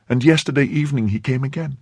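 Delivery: put-on high young woman's statement; anxious maid. A narrator's reading. Maid reporting the man's return, high and anxious.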